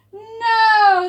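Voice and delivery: high, loud drawn-out yell. A narrator's reading. Crowd answers Pipsqueak, loud high yell. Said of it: "the crowd yelled".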